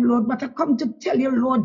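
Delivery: ordinary talk, nothing marked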